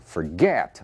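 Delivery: forget with a strong GET; quick FOR, punched GET in forget; stress on the second syllable. Shown as a demonstration. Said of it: "a sílaba tônica é o get".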